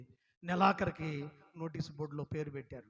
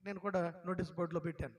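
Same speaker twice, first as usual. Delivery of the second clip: ordinary delivery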